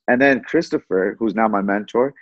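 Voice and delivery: ordinary delivery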